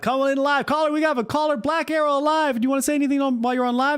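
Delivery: very high, hammed-up live host; call-in show host. Streamer impersonates himself hosting call-ins, hammed-up announcer. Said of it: "I'm doing impersonations".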